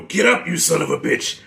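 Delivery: lightly voiced gruff old trainer urging him up; a hint of old Mickey in the corner; ghost of Mick. Reviewer quoting the ghost of Mick, lightly voiced. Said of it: "the ghost of Mick".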